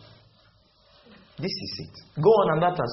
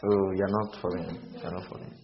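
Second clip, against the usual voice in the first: much softer and lower, a playful chide; mock pity under the breath; soft teasing; 11 semitones lower, 9 dB quieter than usual. Real, not acted. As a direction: tease them quietly for being lost. Teasing congregation, voice drops.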